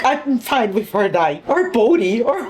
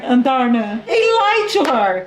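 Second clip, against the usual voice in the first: tearful outrage. Real, not acted. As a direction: wail it in betrayed disbelief. Upset at characters' betrayal.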